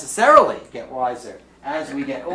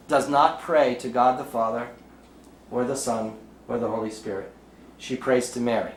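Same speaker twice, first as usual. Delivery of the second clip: ordinary delivery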